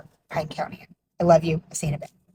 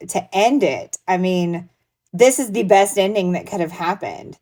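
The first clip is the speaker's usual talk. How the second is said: normal talking voice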